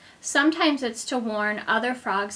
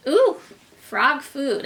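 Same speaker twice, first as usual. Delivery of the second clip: excited ooh; 3 semitones higher, 4 dB louder than usual. Real, not acted. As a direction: gasp with delight at the picture. Excited exclamation at picture.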